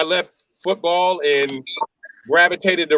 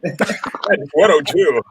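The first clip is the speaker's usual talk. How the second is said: put-on old radio DJ voice. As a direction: lay it on like a classic DJ. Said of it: "everybody used to talk like this".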